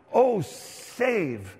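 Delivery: crying out. Acted. Worshipper crying out to God, a mild plea. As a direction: call out a plea, held back. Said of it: "you're crying out to him".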